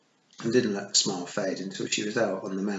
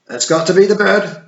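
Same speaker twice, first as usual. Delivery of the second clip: higher, much louder yell; desperate yell; 11 semitones higher, 14 dB louder than usual. A narrator's reading. Rob Anybody stopping Willie, desperate yell. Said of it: "yelled Rob Anybody, desperately".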